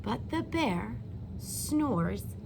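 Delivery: put-on slow, lilting read-aloud to young children; storybook reading. A narrator's reading. Teacher reading a bedtime story to her class, gently lilting. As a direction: read it like story time for little kids.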